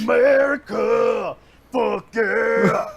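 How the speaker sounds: slow, loud, drawn-out cheer; patriotic shout. Pretend. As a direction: yell it like a proud patriot.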